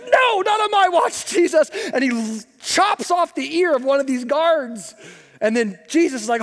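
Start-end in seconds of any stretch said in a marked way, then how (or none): 0.0-1.7 high, loud heroic cry; heroic charge. Acted. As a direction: charge in to save the day. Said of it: "comes in guns a-blazing".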